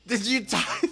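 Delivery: very high, loud squawked question; hammed-up high-pitched yell; mock outrage. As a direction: imitate an upset cousin in a high voice. Acted panicked cousin holding knotted clothes.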